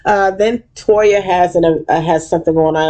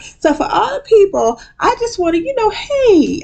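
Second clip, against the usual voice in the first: hammed-up Quad. Quad addressing the room, high sugary voice. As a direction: play Quad reaching out sweetly. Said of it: "she gets out".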